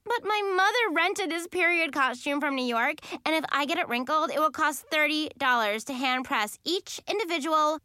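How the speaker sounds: high, hurried complaint; whining protest. A narrator's reading. Child protests, then gets cut off.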